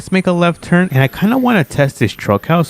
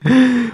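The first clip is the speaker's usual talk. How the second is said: high, sudden gasp of alarm; quick startled gasp; shocked gasp; 10 semitones higher than usual. Acted. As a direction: suck in a breath in surprise. Checks time, finds it late.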